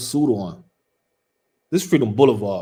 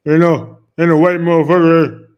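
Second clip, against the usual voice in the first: loud, higher-pitched mock bluster; mocking quote; 5 semitones higher, 10 dB louder than usual. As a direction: imitate his bluster, much louder. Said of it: "He was like".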